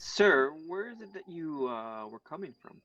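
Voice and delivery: loud call to a stranger. Acted. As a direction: hail someone across the street. Player calls out to NPC.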